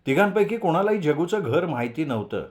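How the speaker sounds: plain reading voice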